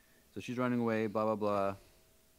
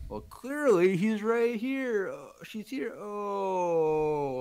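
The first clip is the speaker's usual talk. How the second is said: very high, slow droid-like cartoon voice; exaggerated character imitation; 11 semitones higher, 4 dB louder, much slower than usual. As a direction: mimic the show's expression, stretching words way up high. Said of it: "like, the expression that he had".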